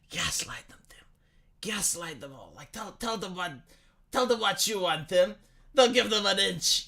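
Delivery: lightly voiced tempter whispering in his ear; evil inner voice. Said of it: "the evil voice inside Tim's".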